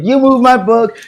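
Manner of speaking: high-pitched mock yell; retold accusing scream. Girl accusing classmate over her book, mock screaming. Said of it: "She just screamed".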